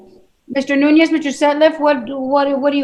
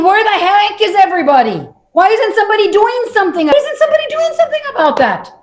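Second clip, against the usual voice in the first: exasperated disbelief; 6 semitones higher, faster than usual. Retold or imitated. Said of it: "And I'm thinking".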